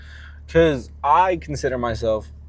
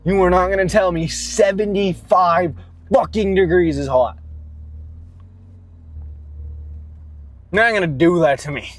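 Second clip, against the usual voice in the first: angry rant; 2 semitones higher, 4 dB louder than usual. Real, not acted. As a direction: yell it like you can't believe anyone would say that. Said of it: "So angry".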